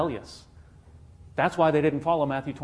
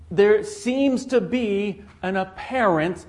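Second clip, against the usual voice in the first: slow, louder, drawn-out apparent; stress on apparent; 5 semitones higher, 6 dB louder, much slower than usual. Shown as a demonstration. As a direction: lean hard on the word apparent, higher and slow. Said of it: "and I emphasize the word apparent".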